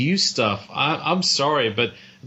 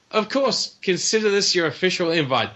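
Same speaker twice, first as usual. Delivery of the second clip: hammed-up host welcome; 7 semitones higher than usual. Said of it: "I replied".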